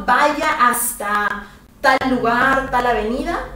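Vaya hasta drawn out slowly; slow, deliberate Spanish model line; slow model phrase. Shown as a demonstration. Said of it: "Go as far as".

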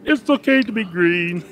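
high frog puppet voice; a puppet frog's high-pitched sign-off; Kermit the Frog. Shown as a demonstration. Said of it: "make your voice sound like Kermit the Frog".

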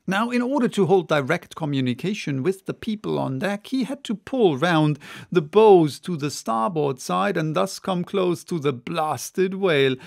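ordinary delivery

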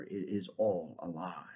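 breathy hushed whisper. Acted. Centaur whispering his verdict to the king. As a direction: breathe it out as a secret.

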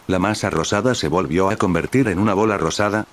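usual speaking voice

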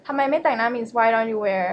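ordinary talk, nothing marked

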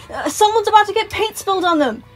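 high, loud urgent warning; yelling warning. A narrator's reading. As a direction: shout the alarm. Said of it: "Moon yelled in his head".

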